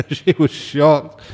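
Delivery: said through a chuckle; slow, amused, laughing as you tell it; tickled by the memory, laughter in the words. Real, not acted. Amused at his own story.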